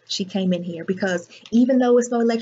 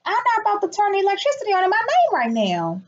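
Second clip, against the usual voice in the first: mock high voice; 10 semitones higher than usual. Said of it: "they was like".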